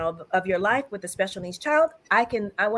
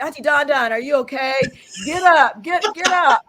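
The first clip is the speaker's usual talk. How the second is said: louder, higher, get up, laughing; urging her up; 3 semitones higher, 6 dB louder than usual. Retold or imitated. Nieces urging their crying auntie up, loud and high. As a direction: coax auntie off the floor, voice rising. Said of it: "And they're like".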